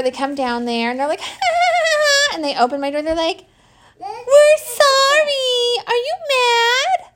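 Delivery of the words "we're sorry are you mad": high, slow, whimpering mock apology; mock sheepish apology. Mom imitating caught teens, high fake-crying apology. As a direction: whine it like a guilty teen caught out.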